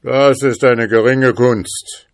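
proud spirit showing off; haughty. A narrator's reading. Spirit boasts it can re-enter the bottle, loud and haughty. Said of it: "Der Geist sprach voll Hochmut".

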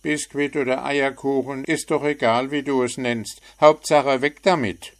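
a chirpy bird poking fun; cheeky little bird. A narrator's reading. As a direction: frecher kleiner Vogel. Bird teasing.

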